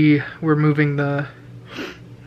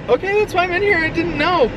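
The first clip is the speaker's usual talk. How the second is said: mock surprised; 13 semitones higher than usual. His own startled reply, retold.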